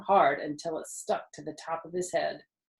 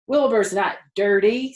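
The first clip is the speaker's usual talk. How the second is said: louder, proud farmer defending his pig; a touch of pride; 3 semitones higher, 8 dB louder than usual. A narrator's reading. Husband defending his pig to his wife, proudly. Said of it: "said Mister Zuckerman proudly".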